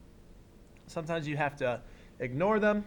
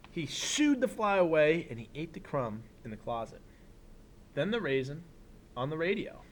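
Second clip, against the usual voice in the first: put-on reading to children; 3 dB quieter than usual. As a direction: read it like story time for a class. Reads picture book aloud.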